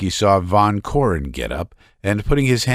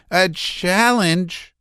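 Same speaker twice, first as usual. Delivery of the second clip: slow stunned question; 11 semitones higher, much slower than usual. A narrator's reading. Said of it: "said Laevsky in a low voice".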